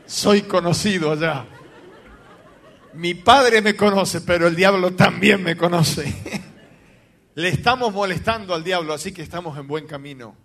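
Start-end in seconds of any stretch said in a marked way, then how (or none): 0.0-6.0 loud slow words through laughter; laughing boast. Real, not acted. Pastor telling his wife about hell, loud laughing boast.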